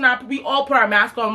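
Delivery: ordinary talk, nothing marked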